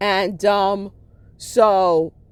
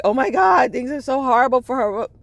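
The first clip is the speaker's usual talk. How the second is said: mock pity; 6 semitones higher, 3 dB louder than usual. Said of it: "So it's like".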